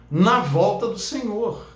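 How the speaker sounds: ordinary delivery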